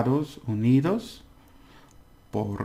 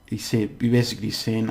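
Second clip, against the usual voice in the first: usual speaking voice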